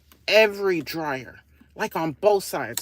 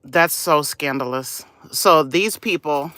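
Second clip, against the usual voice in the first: ordinary talk, nothing marked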